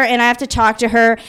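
normal talking voice

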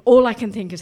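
ordinary talk, nothing marked

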